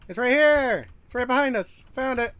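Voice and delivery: high, excited calling out. Real, not acted. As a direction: call it out as you spot it. Finding the present.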